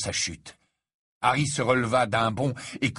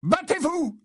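high-pitched yelled challenge; furious shout. A narrator's reading. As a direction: scream at him to fight. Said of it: "lui cria Harry".

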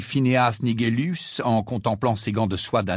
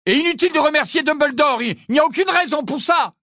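much higher, louder, fast; angry sarcastic shout; 5 dB louder than usual. Acted. Harry shouting sarcasm at the empty frame, fast. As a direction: spit the sarcasm out.

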